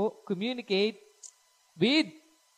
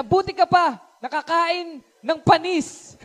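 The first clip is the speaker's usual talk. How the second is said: high, loud, slow sarcastic whine; sarcastic envy; 9 semitones higher, 8 dB louder, slower than usual. Retold or imitated. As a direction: drag it out to mock your brother. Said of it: "Sabi niya". (sarkastikong inggit, mabagal at malakas)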